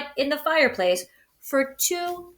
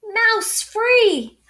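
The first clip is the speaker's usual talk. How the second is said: gleeful cry; 11 semitones higher, 4 dB louder than usual. Acted. Narrator voicing Bear's relief.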